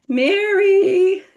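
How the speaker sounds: loud, high call up the stairs. Retold or imitated. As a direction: call her name up to the bedroom. Mom calling daughter downstairs.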